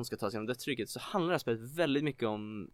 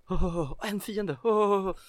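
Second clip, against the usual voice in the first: nervous gasping; 7 semitones higher, 5 dB louder, much slower than usual. Acted. Nervous player spotting an enemy, panicky gasps. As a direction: panicky high, louder gasps. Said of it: "jättenervös".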